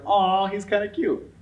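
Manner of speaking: high-pitched cooing "aw"; cooing at cuteness. Acted. People finding the monster cute, high fond coo. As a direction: coo over a harmless little monster. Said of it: "And they're like".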